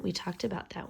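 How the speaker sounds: ordinary delivery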